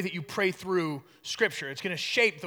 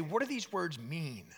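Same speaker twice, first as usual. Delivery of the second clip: quiet reflective asking; 2 semitones lower, 7 dB quieter than usual. Retold or imitated. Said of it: "But come at it and say".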